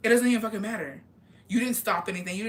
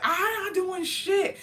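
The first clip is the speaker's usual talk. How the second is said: much higher, slow, drawn-out denial; high whiny protest; 11 semitones higher, much slower than usual. Retold or imitated. To her jealous boyfriend at the restaurant, high drawn-out whine. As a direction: stretch it out, high and indignant.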